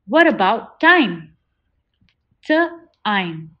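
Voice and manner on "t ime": T-ime split into onset and long vowel; segmented. Shown as a demonstration. Teacher sounding out T-ime for the class. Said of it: "Say it out loud".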